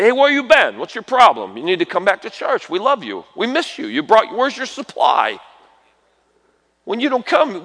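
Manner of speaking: a hint of a caring church friend calling someone back; friendly call to a lapsed member. Church member phoning an absent friend, lightly acted caring call.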